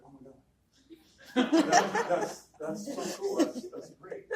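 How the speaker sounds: high, loud squeal of delight; amazed teacher. Retold or imitated. Teacher amazed as her student moonwalks, high excited squeal. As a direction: gush like she can't believe it.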